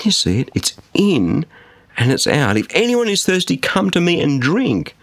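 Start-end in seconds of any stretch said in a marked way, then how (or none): 2.6-4.9 high, ringing preacher's call; impassioned retelling of a public cry; quoted loud proclamation. Retold or imitated. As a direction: call it out to a crowd, slowing on the invitation. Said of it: "said in a loud voice".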